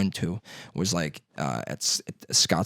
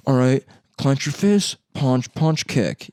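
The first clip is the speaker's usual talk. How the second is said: higher-pitched mock karate teacher calling out moves; karate instructor; 5 semitones higher, slower than usual. Karate teacher drilling kids at day camp, mock instructor voice. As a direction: call out the drill like a karate coach. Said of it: "Mexican dude that would just teach us".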